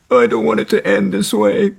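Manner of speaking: high-pitched mock wail; Lars. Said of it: "Lars was pulling his hair going".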